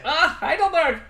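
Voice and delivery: mock German accent, pitched well up; hammed-up German accent, high and bright. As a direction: play a cheerful German praising Heidelberg with a thick German accent. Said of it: "They all say".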